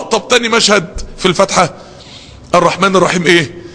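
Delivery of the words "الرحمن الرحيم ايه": usual speaking voice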